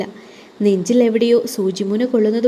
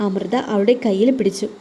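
usual speaking voice

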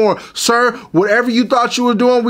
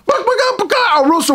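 high, clucking mock chicken; chicken clucking imitation; 9 semitones higher, faster than usual. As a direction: cluck like a farmyard hen. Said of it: "There was a chicken".

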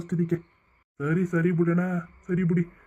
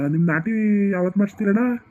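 ordinary delivery

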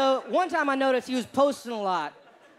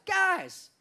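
higher-pitched announcing call; calling for attention; 8 semitones higher than usual. Acted. Posting research to the study group, mock-earnest call for attention. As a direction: address the whole group grandly.

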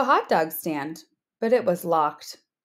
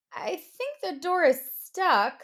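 little pig; 7 semitones higher than usual. Acted. Pig stalls wolf, high voice.

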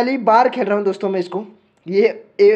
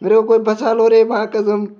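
ordinary delivery

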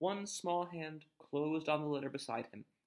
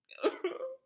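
a high wailing howl; howl like a wounded dog; 5 dB louder than usual. A narrator's reading. Hagrid howls over baby Harry, high and wailing. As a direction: let out a wounded dog's howl. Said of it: "let out a howl like a wounded dog".

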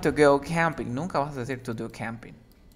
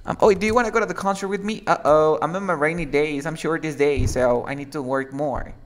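example dialogue voice; 3 semitones higher, 7 dB louder than usual. Shown as a demonstration. Teacher acting out a student turning down a concert, louder. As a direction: play a broke friend turning down a concert. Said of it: "Or you can say".

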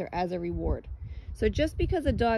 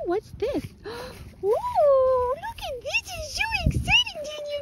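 hammed-up excitement; 4 dB louder than usual. Shown as a demonstration. Trainer luring dog with pretend thrill, gushing high voice. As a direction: fake thrill to lure the dog away. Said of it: "like we're having the best time in the world".

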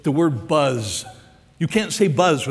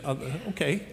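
small, much softer hesitant reply; meek acceptance; 9 dB quieter, faster than usual. Retold or imitated. Preacher acts out Zacchaeus answering Jesus, meek and hesitant. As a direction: mumble a tentative yes.

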